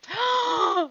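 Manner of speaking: high, delighted gasp; excited gasp. Real, not acted. Gamer spots a new feature, excited gasp. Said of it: "I'm so excited".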